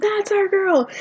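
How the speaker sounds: high-pitched cheer at normal volume; mock scream. Fans greet Colleen at the meet and greet, mock scream. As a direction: act out screaming fans without full volume. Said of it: "And we all screamed".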